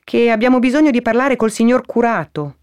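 high-pitched visitor calling up; Toni. Acted. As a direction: explain your errand up to the window. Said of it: "rispose Toni". (voce acuta rivolta alla finestra)

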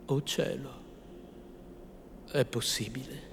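very soft, suspended question; stunned, hushed wonder; hushed disbelief. A narrator's reading. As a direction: barely dare to say it. Hushed reaction to a voice.

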